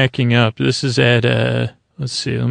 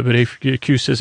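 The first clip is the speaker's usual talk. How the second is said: ordinary delivery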